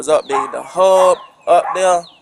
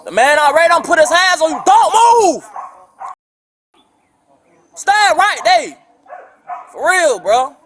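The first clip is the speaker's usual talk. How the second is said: sharp raised shout at his father; 10 semitones higher, 4 dB louder than usual. Real, not acted. Son yelling at his father in front of police, loud. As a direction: bark the orders across the room.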